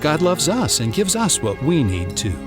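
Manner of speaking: usual speaking voice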